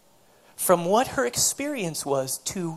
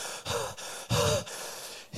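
imitated heavy panting; puffed breaths into the phone; 5 dB quieter than usual. Woman answering the phone out of breath, acted panting. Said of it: "she's out of breath".

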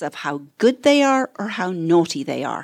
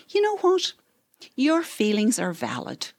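sister's quote; 5 semitones higher than usual. Retold or imitated. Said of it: "And the sister said".

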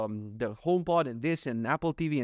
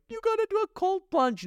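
high mocking hustle-bro voice; mock hustle guru. Mocking productivity gurus, high and sarcastic. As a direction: parody the productivity gurus, pitched way up. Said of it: "They're like".

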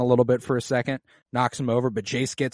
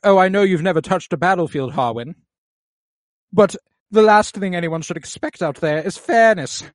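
high, loud, snooty mock knight; haughty taunt at Harwin, higher and louder; prissy Criston; 6 semitones higher, 7 dB louder than usual. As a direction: sneer it like a prissy uber fuckboy. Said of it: "he's all, like, prissy and".

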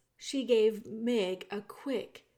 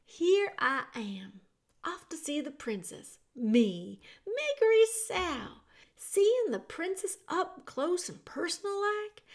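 put-on higher country-girl voice, ending in a whisper; talking to herself; 6 semitones higher than usual. A narrator's reading. Meg talking to herself on her way to the princess. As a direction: chatter to yourself on the stairs, higher. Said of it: "talking to herself as she went".

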